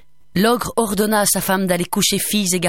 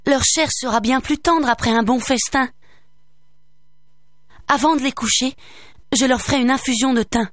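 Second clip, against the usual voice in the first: higher, soothing wife's voice; lightly voiced coaxing wife; 7 semitones higher than usual. A narrator's reading. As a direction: talk him round gently. Wife persuades ogre.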